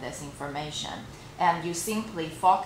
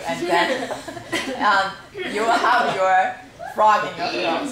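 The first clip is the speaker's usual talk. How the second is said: laughing; 3 semitones higher, 7 dB louder than usual. Real, not acted. Laughing while describing morning voice.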